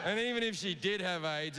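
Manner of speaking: ordinary talk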